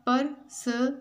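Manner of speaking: "पर्सनैलिटी" in five separated beats; syllable by syllable. Shown as a demonstration. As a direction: break "पर्सनैलिटी" into per-so-na-li-ty with gaps. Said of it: "five syllables हैं इसमें". ("पर्सनैलिटी" को पाँच हिस्सों में तोड़कर)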